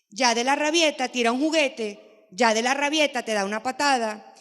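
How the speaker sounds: ordinary talk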